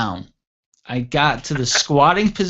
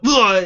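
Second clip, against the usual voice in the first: disgusted groan; 9 semitones higher, 7 dB louder than usual. Retold or imitated. Groaning in the porta john.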